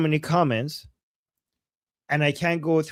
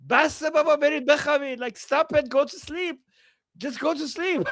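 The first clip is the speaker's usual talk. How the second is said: dismissive scolding; 8 semitones higher, faster than usual. Retold or imitated. Acting dismissive commenter.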